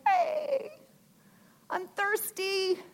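lightly voiced soul, high and soft; a hint of a longing inner voice calling; soul's voice. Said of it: "That's your soul saying".

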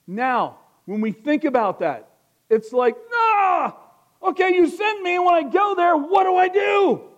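loud, high-pitched sermon shout; full-force preaching. Acted. Preacher voicing a believer unsure what to do when sent, full-force preaching. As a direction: cry out the believer's protest at full volume.

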